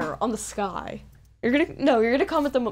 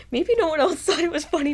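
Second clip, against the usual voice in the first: laughing; 7 semitones higher, 4 dB louder than usual. Real, not acted. Laughing while telling story.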